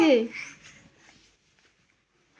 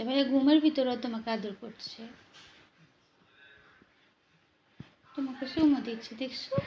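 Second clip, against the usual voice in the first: much softer, hushed tender words; soft hushed talk; 3 semitones lower, 9 dB quieter than usual. Real, not acted. Mother murmuring softly to the baby about his brother. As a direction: murmur tenderly so the sleeping brother stays asleep.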